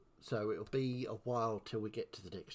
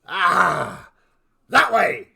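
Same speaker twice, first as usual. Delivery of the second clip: a wolf yelling out loud, higher; loud wolf shout; 7 semitones higher, 18 dB louder, much slower than usual. Acted. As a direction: bark it out as a talking wolf. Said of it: "and it goes".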